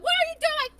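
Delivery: high, loud mock scolding; imitated teammate outburst. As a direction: yell it like an exasperated teammate. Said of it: "And they're like".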